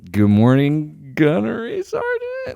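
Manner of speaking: slow, unsure greeting rising high at the end; unconfident greeting. Retold or imitated. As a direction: guess the rank and sound doubtful about it. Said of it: "you don't sound confident enough".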